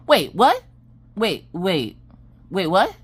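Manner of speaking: stunned, halting repeats; mock disbelief. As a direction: act out stopping short in confusion. Retold shock at wrong restaurant.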